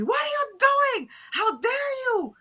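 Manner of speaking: high-pitched, loud mock-scolding dad; retold scolding. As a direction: imitate an outraged parent yelling. Dad angrily scolding in retold story.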